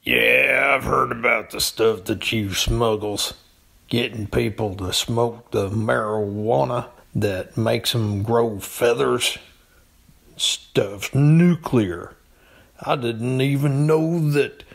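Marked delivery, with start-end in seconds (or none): none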